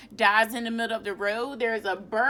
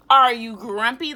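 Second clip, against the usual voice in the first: repeated question; 10 dB louder than usual. Acted. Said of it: "He's asking Norman".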